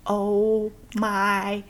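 drawn out very slowly, word by word; imagined parent slowly realizing; stunned dawning realization. Retold or imitated. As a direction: let each word land with slow dread. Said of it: "they must be like".

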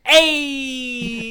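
high, drawn-out "hey" cheer; big rising-spirits crowd cheer; cheer of approval. Shown as a demonstration. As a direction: holler your approval, long and high. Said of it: "you gotta go".

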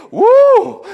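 loud high shout of praise; joyful whoop. Real, not acted. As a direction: let out a big joyful woo. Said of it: "I can say".

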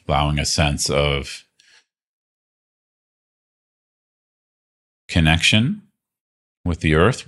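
calm, unhurried meditation guide voice; guided meditation. Acted. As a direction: gently lead them through the body scan with long pauses. Leads a guided body-scan meditation.

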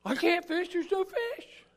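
high-pitched objecting voice; mock protest. Believer whines back at Jesus.

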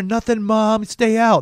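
high, loud brush-off to mom; mock teenage yell. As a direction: imitate a kid yelling through a door. Teen yelling back at mom.

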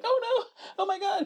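high, laughing mock shriek of terror; mock panicked screams. Hostages in a bank heist scene, laughing high mock shriek. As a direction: squeal it like a panicking hostage while cracking up. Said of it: "everybody was like".

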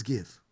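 hushed payoff word; dropped reveal. Real, not acted. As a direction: give dropped low and soft after a pause. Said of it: "Let's pause for a moment".

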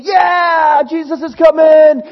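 high, loud cheering shout; cheering crowd. Retold or imitated. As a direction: yell it like a festival crowd greeting Jesus. Crowd celebrating Palm Sunday.

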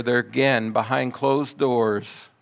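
ordinary talk

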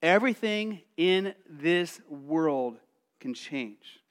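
slowed, each word weighed; slow solemn emphasis; slow, weighty. Shown as a demonstration. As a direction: let it sink in slowly. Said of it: "repeated phrase, slow take".

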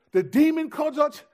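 can't believe what he heard; stunned disbelief. Retold or imitated. Young preacher hearing the demon's name for them, loud astonished outcry. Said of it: "But I remember being like".

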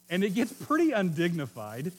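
ordinary talk, nothing marked

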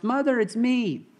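a lightly voiced chick calling out; mild mock shout. A narrator's reading. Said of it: "and he shouts".

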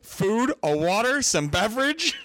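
high, sugary offers of food then a snap; syrupy fake politeness; mock overly sweet host. Friend mocking how she fussed over drunk Jess, high and sugary.

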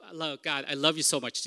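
normal talking voice

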